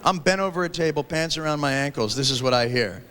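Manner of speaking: usual speaking voice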